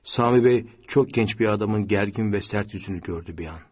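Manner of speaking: ordinary delivery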